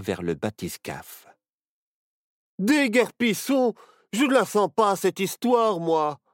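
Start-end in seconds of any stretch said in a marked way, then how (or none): 2.6-6.2 cry of alarm. A narrator's reading. Barnabé crying out to flee the ghosts, loud alarm. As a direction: yell to flee. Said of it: "s'écria Barnabé".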